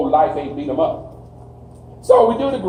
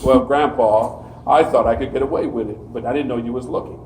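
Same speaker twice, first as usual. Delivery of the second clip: imitating grandkid excuses; 6 semitones lower than usual. Grandkid explaining himself to grandpa, lightly voiced and sheepish. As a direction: mimic a grandkid wriggling out of trouble. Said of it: "they got a whole lecture".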